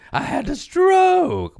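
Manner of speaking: high, slow strained voice; mock stroke voice. Playing aunt after the stroke, slow high strain. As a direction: drag it out like a stroke victim.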